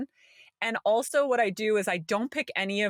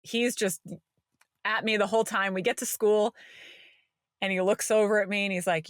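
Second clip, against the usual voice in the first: normal talking voice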